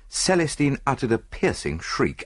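ordinary delivery, nothing marked

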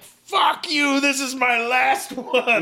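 high shouted defiance, slowing down; defiant shout. Retold or imitated. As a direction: yell it like a stubborn old warrior. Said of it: "he's like".

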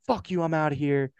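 normal talking voice

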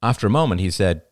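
plain reading voice

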